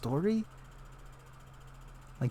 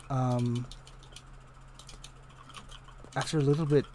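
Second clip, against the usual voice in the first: normal talking voice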